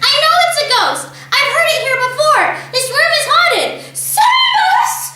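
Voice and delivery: high, loud terrified scream. A narrator's reading. Mother screaming the room is haunted, high and terrified. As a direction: beg to be saved. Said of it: "the mother screamed".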